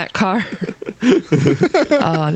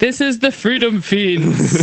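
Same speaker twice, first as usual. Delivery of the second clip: slow, grand announcer delivery; dramatic show intro; theatrical announcement; 3 semitones higher, slower than usual. Acted. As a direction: announce the show name like a movie trailer. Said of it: "I would do it theatrically".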